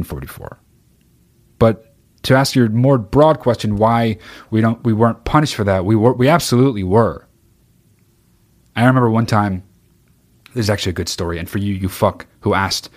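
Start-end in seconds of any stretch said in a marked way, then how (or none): none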